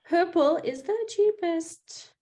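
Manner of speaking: lilting sing-song, slow. Real, not acted. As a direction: sing it out like a tune. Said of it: "I don't know why I did that in a sing-song voice".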